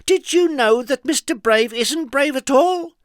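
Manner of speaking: high, loud naughty taunting; mischievous girl. A narrator's reading. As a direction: spill the secret with glee. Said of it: "little Miss Trouble teasing crowd".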